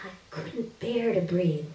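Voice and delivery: usual speaking voice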